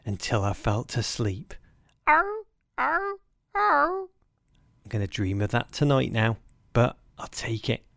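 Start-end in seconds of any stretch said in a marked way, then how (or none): 2.1-4.1 high screeching seal bark; echoing mock seal yelps, very high. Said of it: "Asa Hartford's seal bark echoed in my ears".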